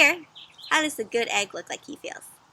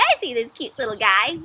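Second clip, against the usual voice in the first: plain reading voice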